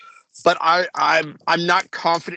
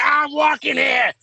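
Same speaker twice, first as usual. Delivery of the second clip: put-on shouted New York accent, pitched way up; New York yell; 11 semitones higher, 4 dB louder, slower than usual. Retelling the Dustin Hoffman movie scene in a shouted New York accent. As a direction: bellow it like a cabbie at a car in Manhattan. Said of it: "All right, Dustin Hoffman".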